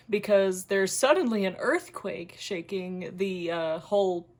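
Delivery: ordinary delivery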